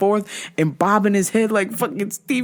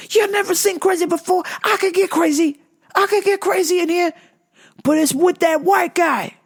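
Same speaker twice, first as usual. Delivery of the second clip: angry rant; 9 semitones higher than usual. Retold or imitated. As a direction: threaten them, worked up. Man ranting at boss again.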